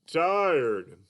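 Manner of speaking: high, slow cartoon puppet voice; Mister Dolphin puppet. Puppet greets the kids as Mister Dolphin, high and slow. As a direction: play a sleepy, sing-song puppet. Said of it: "Mister Dolphin".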